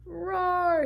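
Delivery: big roaring lion. Acted. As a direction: roar like a proud lion. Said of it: "he added".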